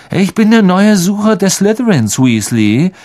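put-on a boy sneering down at his rival; smug and superior. A narrator's reading. Malfoy boasting to Ron about his new place, smug. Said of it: "sagte Malfoy mit blasierter Miene".

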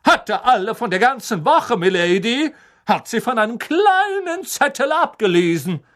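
high, loud courtly boast; proud knight. A narrator's reading. Sir Cadogan proudly explaining to McGonagall, loud and high. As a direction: gallantly boast to milady. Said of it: "sagte Sir Cadogan stolz".

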